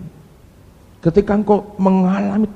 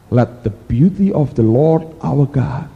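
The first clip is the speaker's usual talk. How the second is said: solemn scripture reading; slower than usual. Acted. Scripture quoted in English.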